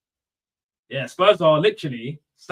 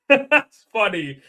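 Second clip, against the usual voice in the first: laughing; 6 semitones higher, 7 dB louder, much slower than usual. Real, not acted. Laughing at a comment.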